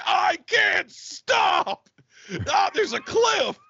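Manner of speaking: high, slow terrified crying shout; panicked screaming. Acted. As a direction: scream as the car goes over. Acted crash panic.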